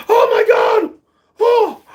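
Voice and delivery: high, loud oh my God; stunned. Retold or imitated. Reliving the knockdown, high disbelieving shout.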